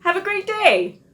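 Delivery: very high, loud, sing-song farewell; peppy ad sign-off. Acted. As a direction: beam it out like a commercial jingle. Ad skit sign-off.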